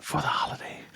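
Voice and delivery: ASMR whisper close to the mic; soft ASMR whisper; whisper in left ear. Pretend. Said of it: "Why are you whispering in my ear".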